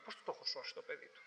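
whispered, baffled question; puzzled whisper. Retold or imitated. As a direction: breathe it out in disbelief. Puzzled reply aside.

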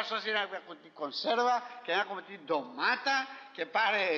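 normal talking voice

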